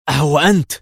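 high, loud gasp of a question; choked exclamation. A narrator's reading. Levi waking to see his own double, choked cry. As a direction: cry out in stunned recognition. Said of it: "وبصوت مختنق هتف ليفي".

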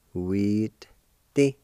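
normal talking voice

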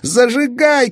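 put-on high loud boyish cry; shouting boy. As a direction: yell the urgent order. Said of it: "крикнул Казик". (Казик кричит: срочно)

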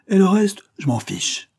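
high, loud, lofty contempt; sovereign contempt. A narrator's reading. Old painter dismissing everything but his canvas with contempt. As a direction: toss the words away with scorn. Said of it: "avec un souverain mépris".

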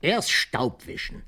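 laut und höher, langsam, nachgespielter Befehl; energisch befohlen. Retold or imitated. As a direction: streng anordnen, gedehnt. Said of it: "hab ich gesagt". (Opa spielt seinen Befehl am Sarg laut nach)

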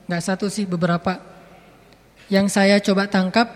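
ordinary delivery

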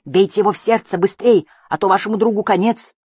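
put-on loud urgent boy's cry; man down shout. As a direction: yell it, high and loud. Boy urging them to strike.

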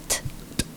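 unvoiced t taps with no vowel; bare t sound. Shown as a demonstration. Teacher demonstrating a bare t, no vowel. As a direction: tap out a t without any vowel after it. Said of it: "hacer el sonido de la T sin la vocal".

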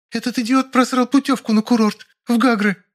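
high-pitched outraged wife; indignant outburst. A narrator's reading. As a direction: spit out the complaint in falsetto. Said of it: "задохнулась от возмущения". (возмущённо, высоким женским голосом)

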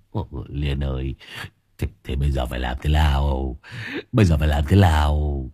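low, pleading husband's voice; helpless husband. A narrator's reading. Husband asks wife what to do, low and helpless.

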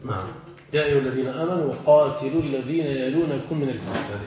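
ordinary talk, nothing marked

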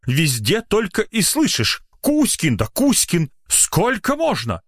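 high-pitched exasperated scolding; indignant. A narrator's reading. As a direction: list the offences in a high, outraged voice. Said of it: "возмущённо произнёс доктор".

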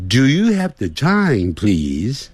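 ordinary talk

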